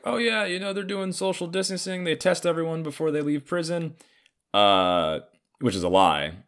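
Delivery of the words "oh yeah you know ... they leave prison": high, dismissive put-on official voice; sing-song brush-off, pitched way up; mocking official. Mocking the prison official's brush-off, high put-on voice.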